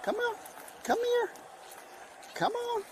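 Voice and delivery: high-pitched coaxing calls; being chased. Real, not acted. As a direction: call the emu in, up high. Cop coaxing a fleeing emu.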